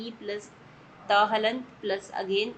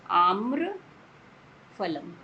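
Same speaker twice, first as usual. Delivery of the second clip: आम्रफलम drawn out very slowly, each syllable held; dictating आम्रफलम very slowly for students to write; slow dictation; 4 dB louder, much slower than usual. Shown as a demonstration. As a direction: spell the word out syllable by syllable. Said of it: "मिला के लिखेंगे आम्रफलम".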